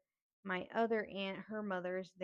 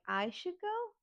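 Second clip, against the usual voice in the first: much higher, incredulous echo question; indignant disbelief; 10 semitones higher, 3 dB louder, slower than usual. Retold or imitated. Niece told to leave by her relatives, indignant echo. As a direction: throw it back in outraged disbelief. Throws the suggestion back.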